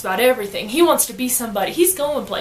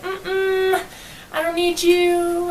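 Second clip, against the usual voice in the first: dismissive; 6 semitones higher, much slower than usual. Retold or imitated. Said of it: "she's like".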